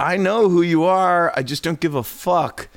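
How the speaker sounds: emphatic mock-exasperated brush-off; exasperated dismissal. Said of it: "It's like".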